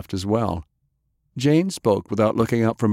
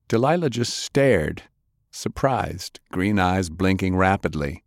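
ordinary delivery

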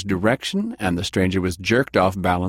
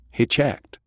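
ordinary delivery

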